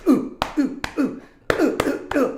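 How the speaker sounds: short, high, loud oofs; mock winded grunts; imitated grunts of pain. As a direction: mimic someone taking punch after punch. Imitates man taking the punches.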